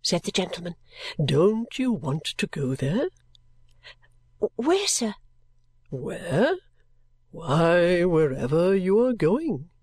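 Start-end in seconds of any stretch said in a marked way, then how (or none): 1.2-3.1 very slow, drawn-out question with swooping tune; eccentric gentleman. Acted. Gentleman's character voice. 4.6-5.1 much higher, small questioning voice; timid child. Acted. Timid child answers stranger. 5.9-9.7 slow, drawn-out character voice with swooping tune; gruff strange gentleman. Acted.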